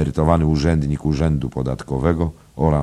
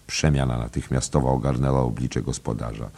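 ordinary delivery, nothing marked

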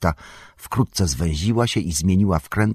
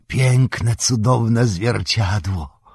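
sighed; 4 semitones higher, much slower than usual. A narrator's reading. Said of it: "westchnął Gimli".

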